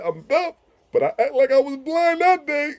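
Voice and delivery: high, loud mock deaf speech; mimicking deaf friend. Retelling a deaf driver's police-chase excuse, high mock deaf voice. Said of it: "he said, he said".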